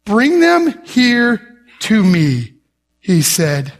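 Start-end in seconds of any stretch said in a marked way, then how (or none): 0.0-2.5 very slow, word-by-word command; weighty command. Retold or imitated. Jesus answering his doubting disciples, slow and commanding. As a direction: give each word room as a deliberate order.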